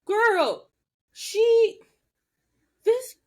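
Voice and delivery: excited squeal. Real, not acted. Excited gossip about the episode.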